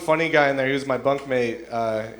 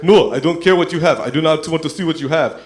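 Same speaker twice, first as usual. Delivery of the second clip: put-on raised, emphatic African-accented refusal; 3 semitones higher, 7 dB louder than usual. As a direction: push back loudly in an African accent. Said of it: "this African man saying".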